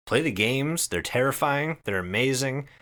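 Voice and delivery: ordinary talk, nothing marked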